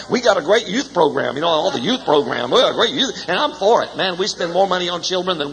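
preaching build. Acted. Preaching build about youth.

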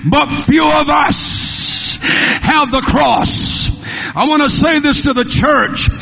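impassioned sermon building to a high cry; full-force preaching. Real, not acted. Preacher warning the church about the cross, full-force preaching. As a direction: preach it hard, stretching the last phrases.

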